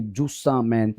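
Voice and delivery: said lovingly. Shown as a demonstration. Teacher to students, cooing जूसा मैन lovingly. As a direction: coo जूसा मैन tenderly like to a child. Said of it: "प्यार से बोलेंगे जूसा मैन".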